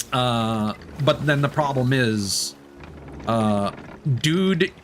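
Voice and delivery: ordinary talk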